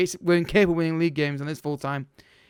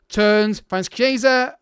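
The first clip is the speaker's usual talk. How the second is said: louder, higher live commentary; excited build-up; 4 semitones higher, 5 dB louder, much slower than usual. Real, not acted. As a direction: voice lifts as the chance builds. Live attack building in game.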